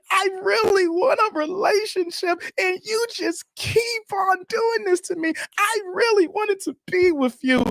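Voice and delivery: mock-sobbing high shout, begging her; fake crying plea. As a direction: ham up a crying, begging ex on the phone. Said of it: "I started crying on the phone".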